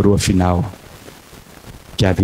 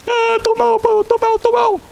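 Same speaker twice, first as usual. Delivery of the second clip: squeaky, much higher whiny "tô mal"; mock miserable friend. Gloomy friend answering the pastor, hammed-up high whine. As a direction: whimper it like a pitiful complainer.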